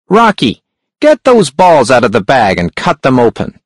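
a sharp, high-pitched hunchback. A narrator's reading. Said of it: "The hunchback's voice was sharp and high".

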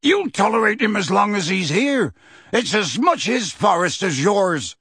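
high-pitched, restrained yell. A narrator's reading. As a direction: shout back indignantly without full volume. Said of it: "he yelled".

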